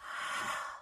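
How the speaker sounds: soft, airy sigh out of the mouth; guided-breathing exhale, demonstrated. Shown as a demonstration. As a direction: release the breath in a voiceless "haaa". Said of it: "out of your mouth".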